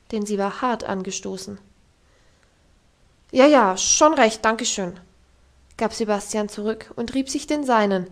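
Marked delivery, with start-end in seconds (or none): 3.3-5.0 loud, slow muttered reply; grumbling servant. Acted. Servant answering the governess after colliding, a hint of grumbling. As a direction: answer while rubbing your sore head. Servant rubbing bumped head.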